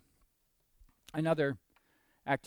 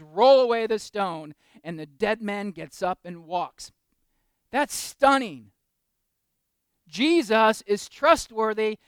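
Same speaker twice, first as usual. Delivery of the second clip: louder, slow preacher's build; preaching at full force; 5 semitones higher, 11 dB louder, slower than usual. Acted. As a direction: proclaim it to the back pews. Preaching Lazarus story.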